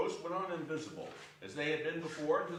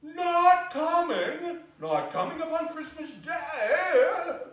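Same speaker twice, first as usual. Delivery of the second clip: very slow, much higher and louder; dismayed father; 11 semitones higher, 8 dB louder, slower than usual. Acted. Bob cries out in dismay that Martha is not coming, slow and loud. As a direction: stretch out the disbelief. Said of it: "didn't like to see him disappointed".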